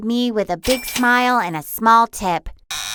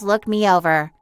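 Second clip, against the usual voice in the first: plain reading voice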